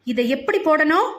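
mother scolding, question rising at the end; understated yell at a child; mild angry shout. A narrator's reading. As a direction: demand an answer with mild anger. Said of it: "என்று இன்னும் கத்த".

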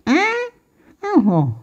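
long, high-pitched mock bleep; imitated censor bleep. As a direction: bleep out the swear word with your voice. Bleeps out her own swear word.

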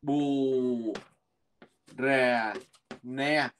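"bù rè nè" said syllable by syllable with pauses; teacher modeling "bù rè nè" slowly, d silent; slow spelled pronunciation. Shown as a demonstration. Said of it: "Cho nên mình sẽ đọc là".

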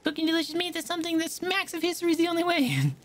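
put-on high, fussy young cook voice; high-pitched cook girl.

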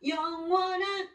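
steeds luider en hoger, langzaam; crescendo. Shown as a demonstration. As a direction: laat het aanzwellen. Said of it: "크레센 점점 세게 갈게요".

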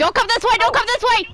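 panicked shout, high and loud; being chased. Real, not acted. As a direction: yell at the chaser to back off.

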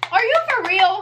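high-pitched indignant disbelief; incredulous. Real, not acted. Addressing the thieves who took only hers, incredulous. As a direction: pitch shoots up in exasperated protest.

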